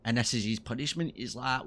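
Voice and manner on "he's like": ordinary talk, nothing marked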